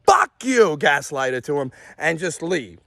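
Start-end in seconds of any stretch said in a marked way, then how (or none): 0.0-0.8 higher, mildly raised mock yell; retold yell. Yelling at Dad before leaving, mock yell. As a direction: punch it out as a retold shout. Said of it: "or I yelled, like".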